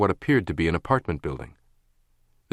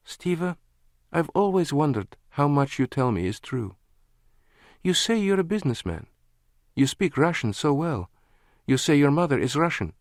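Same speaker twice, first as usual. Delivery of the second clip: higher, searching woman's voice; doubting woman; 7 semitones higher than usual. A narrator's reading. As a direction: probe him gently. Lana doubtful.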